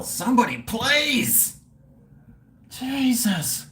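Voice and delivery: high, rising beg for help; exasperated plea. Real, not acted. Streamer begging viewers to search the drink's name, exasperated plea.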